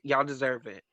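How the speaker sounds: ordinary delivery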